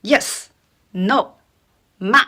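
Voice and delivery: YES, NO, MA each short and falling; quick falling tone. Shown as a demonstration. Teacher matching English to fourth tone, "ma" falling like "no". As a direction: drop "Yes", "no", "ma" one by one. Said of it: "the tone dropped very quickly".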